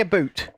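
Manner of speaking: "a boot" with a long oo for "about"; Canadian "about". Shown as a demonstration. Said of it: "was it Canadian or an American".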